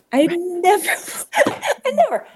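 high-pitched, breaking into a laugh; laughing protest. Real, not acted. Mother insisting she'd never have agreed, laughing. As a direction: protest through real laughter, pitch leaping up.

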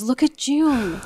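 lightly voiced young daughter; a hint of a child pointing something out; child's voice. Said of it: "Amelia goes".

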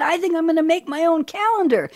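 high-pitched mock declaration; imitated pope. Voices the pope's decision.

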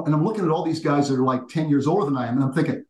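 ordinary talk, nothing marked

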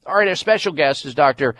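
usual speaking voice

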